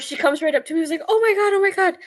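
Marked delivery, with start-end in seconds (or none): 1.1-1.9 high-pitched mock fluster; retold panic. Driver who almost hit a dog, retold high-pitched panic. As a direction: relive the fright in a much higher voice.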